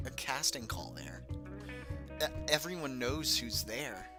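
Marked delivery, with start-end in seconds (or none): none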